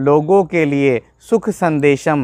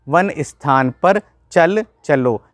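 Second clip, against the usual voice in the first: usual speaking voice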